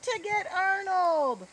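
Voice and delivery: high, loud, drawn-out holler; shout. A narrator's reading. Boyd runs off the field to fetch Arnold, shouting. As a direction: shout it back across the field. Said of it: "Boyd shouted as he ran off the field".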